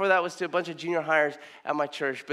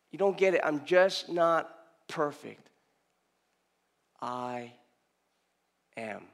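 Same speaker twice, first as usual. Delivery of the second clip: ordinary talk, nothing marked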